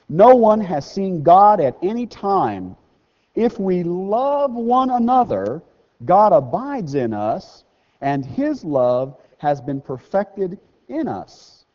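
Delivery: slow, loud solemn reading; measured preacher's scripture read. Acted. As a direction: proclaim the verse slowly.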